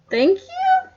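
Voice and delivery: high, puzzled thank you rising; baffled thank you. Real, not acted. Player confused by the coroner's compliment, baffled high thank you. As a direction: thank him as if you don't know why. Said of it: "Who is this guy?".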